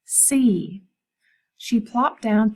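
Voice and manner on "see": "See." as a loud, firm single word; emphatic "See"; stressed "See". A narrator's reading. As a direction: drive home "See.". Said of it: "learn to see".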